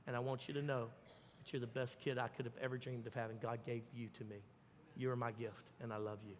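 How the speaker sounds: put-on soft, low, loving words to a child; tender parent. Shown as a demonstration. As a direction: speak gently as a loving father.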